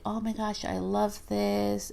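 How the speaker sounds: high, slowed-down swoon over the flowers; mock delight, drawn out; a lightly played thrilled guest; imitating a gushing visitor.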